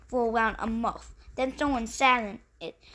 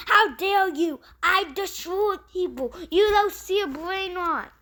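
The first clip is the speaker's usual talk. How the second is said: higher and louder threatening yell; angry shout; 7 semitones higher, 4 dB louder than usual. Acted. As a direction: bellow it like a raging villain. Attacker storms into his house.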